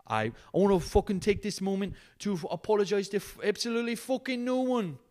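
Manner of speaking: high put-on voice, hammed-up; mock solemn apology. Podcaster mock-apologizing to his listeners, high hammed-up voice. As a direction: fake a grand heartfelt apology, then drop it.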